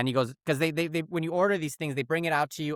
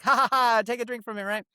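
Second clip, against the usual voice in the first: playful dad teasing; 7 semitones higher than usual. Retold or imitated. Dad urging his kid to try the drink, playful teasing. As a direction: imitate a jolly dad egging someone on. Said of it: "more like".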